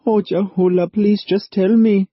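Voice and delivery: high, loud eager begging; pleading. A narrator's reading. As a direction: plead with him. Sal begs Cartwright.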